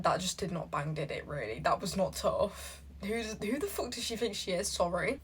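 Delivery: ordinary talk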